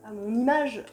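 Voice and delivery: ordinary delivery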